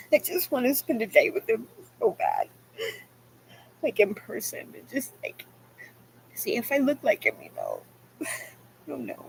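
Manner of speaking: tearful, fading to a near whisper; close to tears. Real, not acted. Daughter aching to meet her dad, tearful and fading. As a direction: let the voice give out with longing. Crying over wish to see dad.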